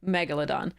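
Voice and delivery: megalodon stressed on the first syllable; correct pronunciation. Shown as a demonstration. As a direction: say MEG-uh-luh-don for megalodon. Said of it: "the correct pronunciation is megalodon".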